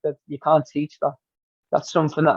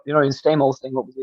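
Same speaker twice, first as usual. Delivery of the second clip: ordinary talk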